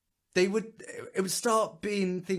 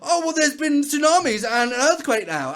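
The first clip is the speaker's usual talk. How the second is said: higher, louder, smug parody voice; mock one-upping; 9 semitones higher, 6 dB louder than usual. Mocking people jumping in with new disasters, smug high parody. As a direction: lay it on thick like a tragedy hipster. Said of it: "people jumping and going".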